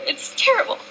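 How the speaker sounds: fighting back tears mid-speech, words squeezed out high; getting emotional. Real, not acted. After reading students' letters, choked up with real emotion. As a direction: voice catching, pitch jumping up as real emotion breaks through.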